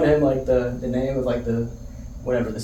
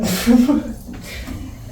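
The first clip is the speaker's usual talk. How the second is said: laughter; 11 semitones higher than usual. Real, not acted. Laughing at the rude train worker.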